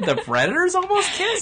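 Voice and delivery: high, laughing incredulous question; can't believe it, laughing; laughing disbelief. Real, not acted.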